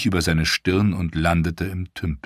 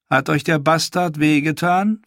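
much higher, louder sneering woman's voice; mocking washerwoman; 10 semitones higher, 5 dB louder than usual. Holly sneering at Theon, high and mocking. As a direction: taunt him with sweet cruelty. Ash mock-pities Theon.

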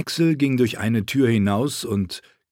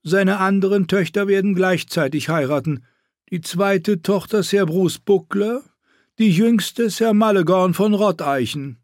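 evil queen; 10 semitones higher, 3 dB louder than usual. Acted. Queen continues.

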